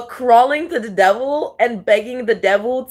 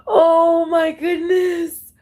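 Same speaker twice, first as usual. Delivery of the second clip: high, slow words drawn out through laughter; laughing; 7 semitones higher, much slower than usual. Real, not acted. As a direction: stretch it out, still laughing. Long laugh at video.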